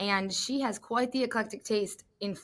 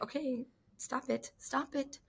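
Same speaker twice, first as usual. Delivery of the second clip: higher, soft, slow pleading; emotional self-restraint; 3 semitones higher, 10 dB quieter, slower than usual. Retold or imitated. Commentator telling herself not to get emotional, softly. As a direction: hold back tears in a small voice. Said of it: "She got a little emotional".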